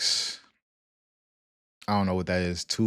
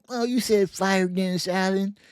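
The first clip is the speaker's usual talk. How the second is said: higher, slow mocking voice of a doubter; mocking quote; 9 semitones higher, slower than usual. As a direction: drag it out, mocking a fan. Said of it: "And Check Twist Watch said".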